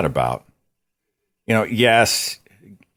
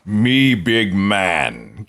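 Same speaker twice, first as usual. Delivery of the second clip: slow, lumbering caveman grunt; mock caveman bragging; 3 semitones higher, much slower than usual. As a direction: play a dim macho caveman, slowly. Mocking macho guy talk.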